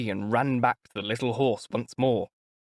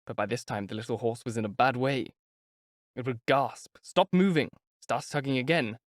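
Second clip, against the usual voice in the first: ordinary talk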